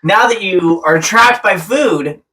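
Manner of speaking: high-pitched, drawn-out pretend accosting; mock ambush greeting. Blindsiding the actor while he eats, playful mock ambush. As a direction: pounce playfully on a cornered celebrity.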